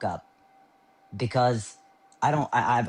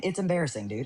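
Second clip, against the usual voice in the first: ordinary talk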